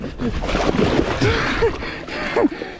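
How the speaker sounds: airy burst of laughter; breathy laugh. Real, not acted. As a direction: laugh it off after slipping. Laughs after falling off kayak.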